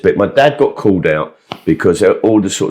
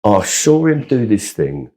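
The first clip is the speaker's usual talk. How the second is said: normal talking voice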